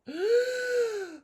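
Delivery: a sudden high gasp of realization; sharp high gasp. Retold or imitated. Client suddenly remembering being left in the crib, gasping. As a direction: gasp as it dawns on you.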